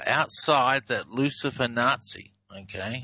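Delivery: ordinary talk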